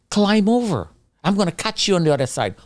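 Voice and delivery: imitated high call over a wall; urgent calling out. Rescuer urges trapped man over the wall, high retold call. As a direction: pitch it up, urging someone to climb.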